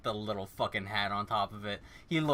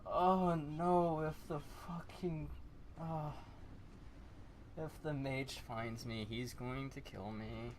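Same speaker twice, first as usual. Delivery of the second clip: higher then soft, scared; mild frightened murmur; 3 semitones higher, 5 dB quieter than usual. Acted. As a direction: fret quietly about the mage. Terrified whispered worry.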